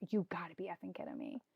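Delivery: lightly whispered retold shock; hushed disbelief. Retelling her words to her sister in the car, in hushed disbelief.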